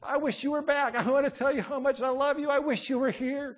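high, loud, aching plea; grief-stricken longing. Acted. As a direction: cry out the wish in acted grief. Imagines girl still grieving.

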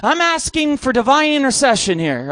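ordinary talk

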